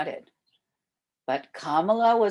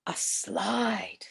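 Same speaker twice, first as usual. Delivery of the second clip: whisper; 5 dB quieter than usual. Acted. Sister suggests idea in secret.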